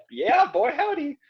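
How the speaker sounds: put-on high, hammy "Yeah, boy, howdy"; mock folksy yee-haw; hammed-up cowboy exclamation. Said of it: "my terrible impression".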